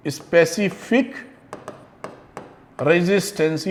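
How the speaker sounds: "Specific resistance" measured and quiet, broken into separate pieces. Shown as a demonstration. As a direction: dictate "specific resistance" word by word for note-takers. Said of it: "लिख विख लीजिएगा".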